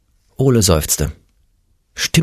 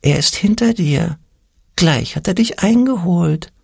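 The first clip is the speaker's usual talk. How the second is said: high, thin voice of doubt; thin little voice; 10 semitones higher than usual. A narrator's reading. Little voice warns Ole of his pursuer, thin and high. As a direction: warn him in a tiny high-pitched voice. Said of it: "ein dünnes Stimmchen".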